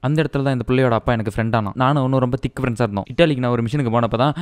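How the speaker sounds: low, level-pitched hero telling his backstory; mock flashback recital. Hero recounting his Kashmir childhood and army past, mock-solemn recital. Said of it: "flashback சொல்றியா?".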